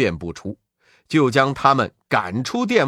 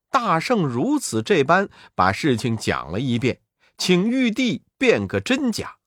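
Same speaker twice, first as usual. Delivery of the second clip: ordinary delivery, nothing marked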